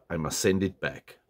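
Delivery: ordinary delivery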